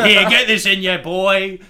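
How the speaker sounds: boisterous comic butler, easing at the end; Alfred. Acted. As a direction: urge it on loudly in mock English. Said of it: "when Alfred comes".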